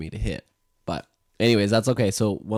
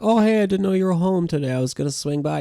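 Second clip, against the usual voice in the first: playful buddy voice; 12 semitones higher, 7 dB louder, faster than usual. Pretend. Voicing love as a friend.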